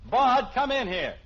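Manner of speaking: calling out. Real, not acted. Father summoning his son to his room, loud and slow. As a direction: call him in firmly.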